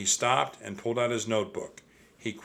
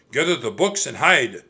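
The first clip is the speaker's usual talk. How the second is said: loud, pressing command; urgent order; 3 semitones higher, 7 dB louder than usual. A narrator's reading. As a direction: send everyone into hiding. Monk urgently ordering the others.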